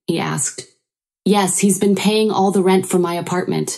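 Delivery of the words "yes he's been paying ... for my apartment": plain reading voice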